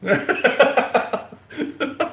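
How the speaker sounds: high bursting laugh at own pun; cracking up at her own joke; big laugh. Real, not acted. Streamer laughing at her own pun, a big high laugh.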